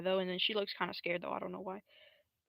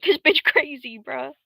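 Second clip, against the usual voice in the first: loud, higher-pitched exclamation; mock outrage; 6 semitones higher, 13 dB louder, slower than usual. Character reacts to the crazy one, loud mock outrage. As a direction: blurt it out in hammed-up disbelief.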